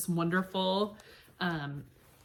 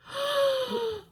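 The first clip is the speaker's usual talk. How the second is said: delighted gasp. Real, not acted. Delight at polka dot mug.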